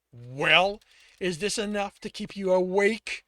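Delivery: loud menacing taunt; angry taunt. Acted. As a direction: sneer it out loud. Torturer taunting loudly.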